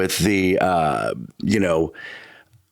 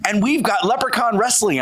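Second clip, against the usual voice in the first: high-pitched mock radio promo; hyped-up ad read, pitched high; radio ad announcer; 11 semitones higher than usual. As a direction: imitate an excited event advert. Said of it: "they were doing an advertisement".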